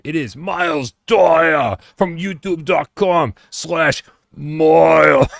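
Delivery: slow, loud British monster truck announcer; British wrestling voice. Acted. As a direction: bellow the name like a monster truck rally host. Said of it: "My British monster truck wrestling voice".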